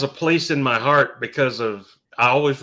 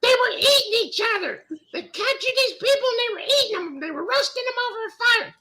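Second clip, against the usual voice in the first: high, breathless gossipy mom voice; mom's voice. Retold or imitated. Mother describing the cannibal movie to her son, high and scandalized. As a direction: tell the gore like a scandalized mother. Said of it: "My mom really talks like this".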